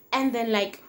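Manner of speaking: ordinary delivery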